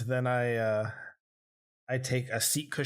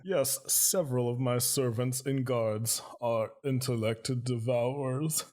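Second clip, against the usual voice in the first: put-on old man voice. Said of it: "I get his old man voice as best that I can".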